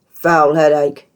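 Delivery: slow, loud irritable snap; snapping. Acted. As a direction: bite the words out, hungover and cross. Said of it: "he snapped".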